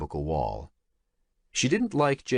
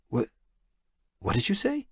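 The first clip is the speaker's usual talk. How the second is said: stammering; 12 semitones higher than usual. Acted. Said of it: "he stammered".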